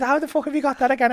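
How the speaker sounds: high, fast, loud incredulous quote; mock astonishment. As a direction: imitate a gobsmacked mate, pitched right up. Manager's astonished reaction, retold.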